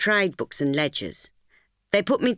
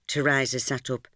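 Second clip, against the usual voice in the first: plain reading voice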